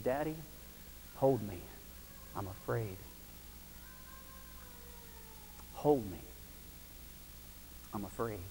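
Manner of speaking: scared whisper. Retold or imitated. Frightened daughter clinging to dad.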